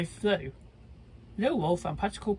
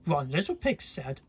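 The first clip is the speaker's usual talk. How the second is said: plain reading voice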